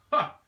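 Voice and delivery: one sharp high bark of a laugh; a loud mocking "Ha!"; scoffing laugh at the idea of allies; single scornful laugh. A narrator's reading. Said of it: "She threw a single laugh at her".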